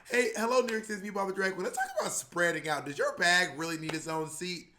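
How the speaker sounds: bright sassy public service spiel; announcement voice. Retold or imitated. [name] greeting New York City over the platform speakers, bright and sassy.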